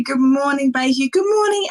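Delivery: usual speaking voice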